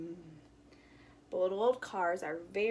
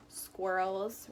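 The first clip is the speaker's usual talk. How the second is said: ordinary delivery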